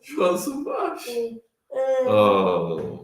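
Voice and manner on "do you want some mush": a dad offering mush in a squeaky voice. Real, not acted. Coaxing baby to eat.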